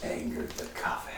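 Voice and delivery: low, very slow whispered verdict; soft creature voice with long pauses; menacing witch. Acted. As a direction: pronounce doom in a drawn-out hush. GM passes sentence as the witch.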